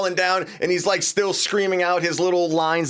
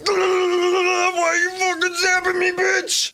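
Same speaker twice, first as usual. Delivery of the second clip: much higher, drawn-out slow yell; outraged yell; 12 semitones higher, much slower than usual. Retold or imitated. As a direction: stretch the complaint out as a yell. Wolverine yelling when zapped.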